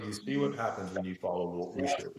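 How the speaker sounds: low and soft, a lightly voiced mentor; mentor's calm voice. Mentor telling her she followed his teaching, low and soft. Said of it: "And he goes".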